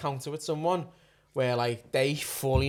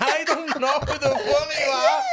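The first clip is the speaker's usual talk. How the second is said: laughing disbelief; 10 semitones higher, much slower than usual. Retold or imitated. Retold inner reaction, laughing.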